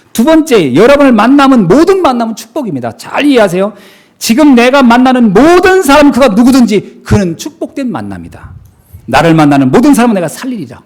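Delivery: loud, high, driving sermon delivery; preaching at full force. Real, not acted. Pastor preaching to the congregation at full force. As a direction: proclaim it to the whole hall.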